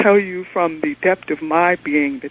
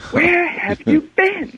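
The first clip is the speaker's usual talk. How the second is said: put-on a light high-pitched child, slow; child asking where parent went; child's voice; 13 semitones higher, slower than usual. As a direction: mimic the worried child.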